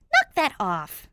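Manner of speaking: loud irritated snap; loud annoyed snap; snapping. Acted. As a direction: snap at him to stop. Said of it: "Danny snaps".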